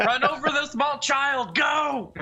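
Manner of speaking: high, laughing battle cry; mock shouted order. Player to the sprinting crowd, high mock-urgent shout. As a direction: urge the stampede on gleefully.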